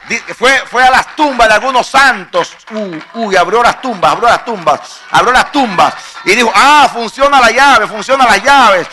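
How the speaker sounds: loud, driving sermon climax; fiery pulpit preaching; preaching at full force. Acted. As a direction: preach it hard to the crowd.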